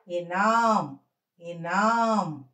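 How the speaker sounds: slow ఇనాం with the naa held long; teacher's stretched model of ఇనాం; pronunciation demo. Shown as a demonstration. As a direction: draw out నా in ఇనాం, close on m. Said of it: "ఇనాం అని చదవాలి".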